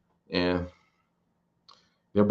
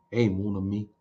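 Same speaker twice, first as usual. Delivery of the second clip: a softer, lightly voiced Cajun French "Hey, mon ami"; Cajun French accent; 4 dB quieter than usual. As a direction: greet someone easily with a hint of Gambit's Cajun French. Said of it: "Get that French accent, that Cajun accent".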